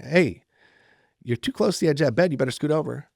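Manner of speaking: firmer warning. Retold or imitated. Uncle warning nephew on the bed's edge, firmer warning.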